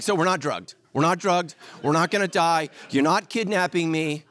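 mock-relieved guest babbling that nobody is drugged; relieved babble. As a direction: blurt out the relief all at once. Relieved panic retold.

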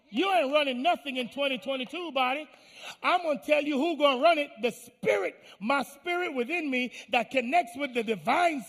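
high, loud, commanding pulpit shout; full-force preaching. Acted. Preacher orders the body to submit, in forceful high shouting. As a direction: tell the body off at full volume.

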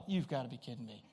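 low, soft muttered disbelief under the breath. Retold or imitated. Thunder breaks on raft trip.

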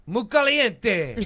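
put-on high, loud, drawn-out Mexican accent; Mexican cow. Doing regional cows, slow loud Mexican accent. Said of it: "Mexico's would be".

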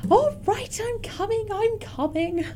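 put-on frail old lady calling out; high, thin old woman's call. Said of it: "this frail old lady".

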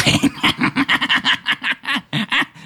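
high, breathy creature cackle; kobold cackle. Acted. GM as Grey Gut cackling at the party, high and breathy. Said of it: "He just cackles".

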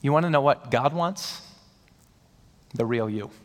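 ordinary talk, nothing marked

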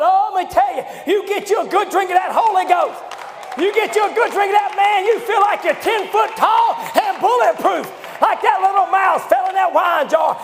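rising, shouted preacher's build to the fight; fiery preaching. Acted. Preacher rousing the church to fight, shouting.

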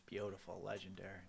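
low whispered tribute; reverent hush. Acted. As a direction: breathe it in awe. Reverent hush about [name].

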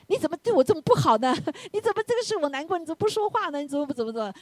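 high-pitched complaining spouse; hammed-up whiny grievance, high; mock nagging. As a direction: imitate a reproachful partner, half laughing.

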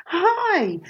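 a hint of a showbiz host; mirror-practice hello. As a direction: greet an imaginary online crowd. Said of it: "in front of the mirror and I go".

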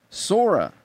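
high, loud yell across the square; high-pitched call to a friend far off. Acted. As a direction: shout his name from over by the door. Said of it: "Donald was yelling it for him".

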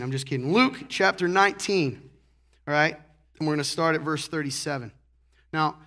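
normal talking voice

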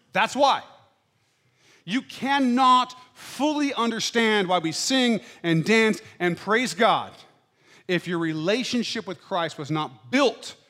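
pastor urging the congregation to understand mourning, fervent preaching; preaching at full force. Acted. Full-voiced call, every word weighted.

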